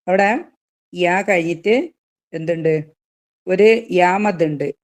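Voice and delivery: ordinary delivery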